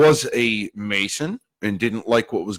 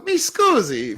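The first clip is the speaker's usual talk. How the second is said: mi scusi squeaked much higher in mock Italian; slower than usual. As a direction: pitch mi scusi way up like a startled Italian. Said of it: "He goes".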